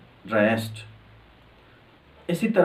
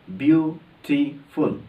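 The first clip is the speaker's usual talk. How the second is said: Beautiful slowly split into three beats, ब्यू-टी-फुल; syllable by syllable; much slower than usual. Shown as a demonstration. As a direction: break beautiful into three separate syllables with gaps. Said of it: "ये तीन दफ़ा अदा हो रहा है".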